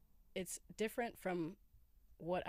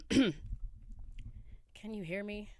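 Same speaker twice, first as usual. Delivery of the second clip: morning voice; 3 semitones higher, 5 dB louder than usual. Real, not acted. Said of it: "Which octave am I gonna be in today?".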